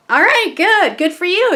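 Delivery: high, lilting praise; proud encouragement. Retold or imitated. Mom approving her son's plans, high and encouraging. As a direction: praise with a lifted, swooping pitch.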